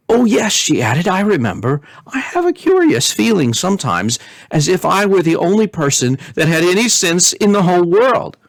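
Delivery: high, bubbling princess voice; giddy princess. Acted. Princess boasting to king and queen. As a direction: float up with giddy glee. Princess giggly, feeling superior.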